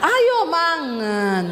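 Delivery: "ayo mangan" starts high, slides slowly down; high drawn-out call that sinks at the end; slipped Sulawesi tone. Retold or imitated. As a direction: attempt Javanese falling tone after a high start. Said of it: "tiap hari belajar tapi masih keceplosan".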